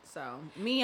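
normal talking voice

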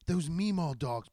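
ordinary talk, nothing marked

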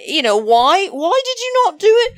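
high pitched, fast disbelieving why; exasperated. Real, not acted. Host scolds a contestant for not playing, exasperated high question. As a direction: demand an answer in disbelief.

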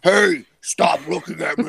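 slow mock demon yell. Retelling Suzanne turning into a demon, mock demon voice. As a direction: draw it out like a demon breaking through. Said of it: "the demon voice comes out".

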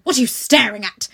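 loud, sharp snap; sharp demand. A narrator's reading. Headmistress snapping at the staring girl: sharp demand. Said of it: "demanded Miss Minchin sharply".

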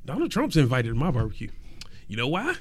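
ordinary talk, nothing marked

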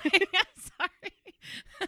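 high, squeaky laugh through the words; laughing apology. Real, not acted. Co-host losing it after her own bit, laughing apology. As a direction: apologize while cracking up, drawn out.

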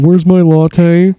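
slow, dim voice; drawn out like a clueless big guy; slow imitation of the thick boy; dopey thick boy. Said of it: "This big, thick boy going".

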